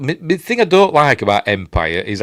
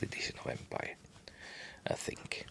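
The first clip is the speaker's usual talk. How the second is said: whispering; 10 semitones lower, 26 dB quieter than usual. Real, not acted. Aside after deciding.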